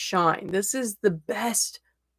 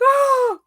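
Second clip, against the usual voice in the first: high, loud re-enacted "oh"; overwhelmed squeal; 8 dB louder than usual. Teacher reliving seeing all the kiddos, overwhelmed squeal. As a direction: squeal as if swept away by delight. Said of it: "Got so overwhelmed by seeing all my kiddos".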